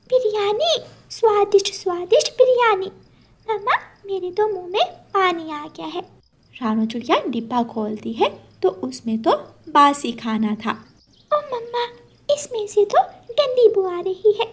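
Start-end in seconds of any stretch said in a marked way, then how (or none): 0.1-6.0 put-on higher, delighted child's voice; light up at the biryani; excited little girl. Daughter thrilled by mother's biryani, higher excited. 11.3-14.5 put-on higher child's voice, put off; disgusted little girl. Daughter finding the food stale, higher dismayed.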